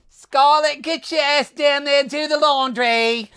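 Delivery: put-on high, slow Irish-accented bossy call; Daisy impression. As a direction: boss her around in an Irish lilt. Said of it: "That's my Daisy".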